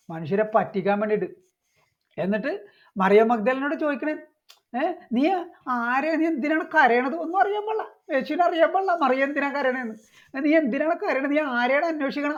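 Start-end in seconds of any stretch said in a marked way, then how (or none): 4.8-12.4 high-pitched, feigned cluelessness; mock innocence. Mimicking Jesus asking Mary why she weeps, mock innocent. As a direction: play dumb in a raised, mocking voice. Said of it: "അയ്യടാ പാവം യേശു എന്ന് തോന്നും".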